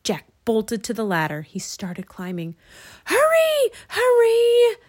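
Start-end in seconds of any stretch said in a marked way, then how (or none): 3.0-4.8 high, loud frantic scream; piercing urgent yell. A narrator's reading. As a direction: scream for him to climb faster. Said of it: "screamed Annie".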